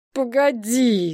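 slow, drawn-out petulant plea; capricious whine. A narrator's reading. Drunk woman stops her friend leaving, capricious drawn-out whine. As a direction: stretch it into a sulky whine. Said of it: "закапризничала Геля".

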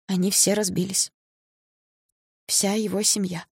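high, halting grief; tearful. A narrator's reading. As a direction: force the news out through tears, with pauses. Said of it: "хриплым от слёз голосом".

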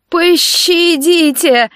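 high, drawn-out bleat of terror; bleating plea. A narrator's reading. Captive begs mercy of the animal, bleating in fear. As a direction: stretch it into a pitiful bleat. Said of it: "дрожа проблеял Нейт".

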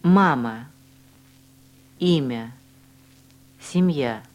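ordinary talk

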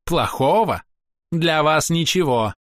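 haughty elf, high ringing voice; theatrical, scornful ranting; proud elf. Acted. Castiel answers.

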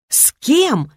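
baffled question. A narrator's reading. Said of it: "в один голос спросили дети".

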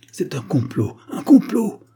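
alarmed cry leaping high and loud; high outcry. A narrator's reading. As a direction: exclaim it, rising sharply on the repeat. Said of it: "s'écria Agar".

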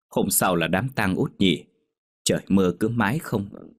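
ordinary delivery

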